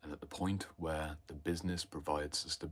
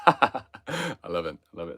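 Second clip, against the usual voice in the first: laughing delight; 2 semitones higher, 15 dB louder, slower than usual. Real, not acted. Amused by Germans saying super cool, laughing out loud. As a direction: laugh through it, tickled.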